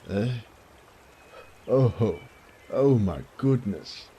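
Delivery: put-on an old father who can't believe his eyes; startled joy from the elderly baron; astonished old father. Father sees lost son return.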